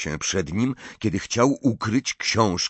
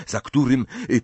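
plain reading voice

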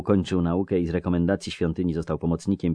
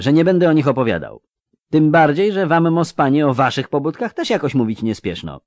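a lightly voiced earnest youth; curious young clerk; young scribe voice; 10 semitones higher, 10 dB louder than usual. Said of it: "uciął Jare".

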